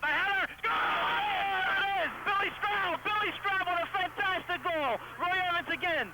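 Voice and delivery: high, loud shout of triumph; excited goal call. Real, not acted. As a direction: erupt as the ball goes in. Goal scored, commentator erupts live.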